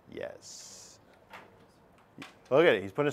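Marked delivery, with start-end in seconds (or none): 0.1-1.0 a secretive whispered yes. Acted. Teacher whispers to class that it is on the test.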